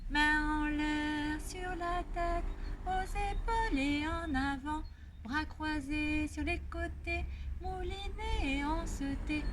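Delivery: slow sing-song action rhyme; calming rhyme. Shown as a demonstration. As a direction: lead the gestures slowly.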